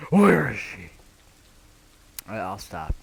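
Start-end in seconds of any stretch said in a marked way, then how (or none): 0.1-0.9 hammed-up Batman shout, louder and higher; mock Batman yelling for Rachel; Batman impression. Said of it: "That's my best Batman impression".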